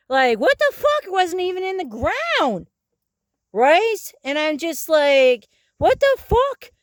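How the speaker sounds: high, disbelieving outburst; incredulous outrage. Real, not acted. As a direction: throw up your hands in disbelief. Outraged rant about bases.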